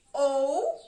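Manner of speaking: loud, separated syllable ou; teacher counting syllables in outono; syllable clapped out. Shown as a demonstration. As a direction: punch out ou as its own beat. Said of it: "Eu vou marcar a sílaba".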